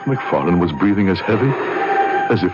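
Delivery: low, measured storytelling voice; story narration. A narrator's reading. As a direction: look back and tell it like a memoir. Narrator recounts the past.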